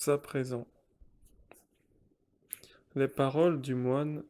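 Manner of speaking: ordinary talk, nothing marked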